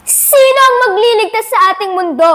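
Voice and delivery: high, ringing rhetorical question; impassioned challenge. Acted. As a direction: throw the question out to the crowd.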